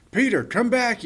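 high, loud call after his brother; yell. Acted. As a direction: shout it across the schoolyard. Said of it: "yells Billy".

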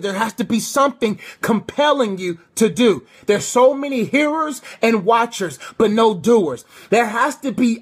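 fervent preacher building intensity; preaching. Acted. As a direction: preach it out to the congregation.

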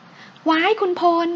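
high, stretched-out startled cry; startled squeal. Acted. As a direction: yelp as he scoops her up. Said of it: "หญิงสาวตกใจ".

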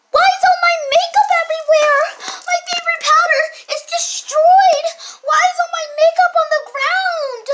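high, loud wailing shout with a crying laugh; upset sister shout. Acted. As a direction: wail over the ruined makeup. Sister finds makeup destroyed.

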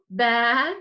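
ordinary talk

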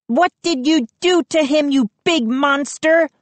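high, outraged cry; accusing shriek. A narrator's reading. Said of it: "Mindy shrieked".

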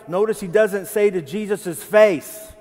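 usual speaking voice

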